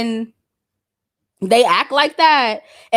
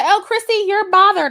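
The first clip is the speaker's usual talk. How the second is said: higher-pitched imitation of a critic; mock accusation; 8 semitones higher than usual. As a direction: mimic an accuser, pitched up high. Voices a critic's imagined retort.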